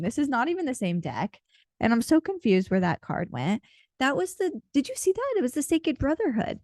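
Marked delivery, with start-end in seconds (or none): 4.0-6.6 suddenly high, caught off guard; startled surprise. Real, not acted. Reader startled when a card vanishes, pitched way up. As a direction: pitch jumps way up in real disbelief.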